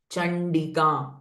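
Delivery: Chandika with a nasalized retroflex nd, slow; Chandika pronunciation. Shown as a demonstration. Teacher modelling Chandika for students, slow and nasal. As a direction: Chandika drawn out, the n through the nose. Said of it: "all n sound".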